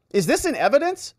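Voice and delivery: high-pitched, sharply questioning; mock judge's query. Judge asking whether the exhibit is in evidence, querying. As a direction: interrupt with a high, pointed question.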